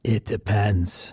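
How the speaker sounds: low, slow and unhurried on-air voice; calm, measured radio presenter delivery; public radio host. Acted. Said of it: "public radio voice".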